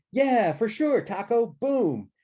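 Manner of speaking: high, loud yell of acceptance; excited shout. A narrator's reading. Maggie says yes to prom over the freshmen, shouting. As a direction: shout it gleefully across a noisy hall. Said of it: "Maggie shouted".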